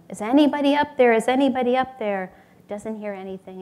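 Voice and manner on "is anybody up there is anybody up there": loud, urgent mock yell. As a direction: call up for someone out of earshot. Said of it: "He's yelling".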